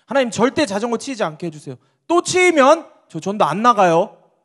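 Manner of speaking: loud, high-pitched demanding plea; protesting prayer. Pretend. Ordinary believers praying never to be hit, loudly protesting. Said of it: "우린 나갈 때마다 반대로 기도하지 않겠습니까".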